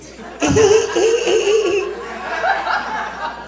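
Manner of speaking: squeaky, drawn-out put-on giggle; high-pitched giggle. Comic capping his boxer impression, high mock giggle.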